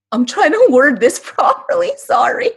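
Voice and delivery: real tearful laugh, pitch breaking up high; fighting back tears with a laugh; choked-up apologetic laugh; laughing through tears. Real, not acted. Overcome while explaining land struggle.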